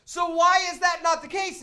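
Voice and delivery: retold indignant rant, building from firm to shouting; exasperated customer building to a high, loud protest; raising his voice. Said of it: "you're raising your voice".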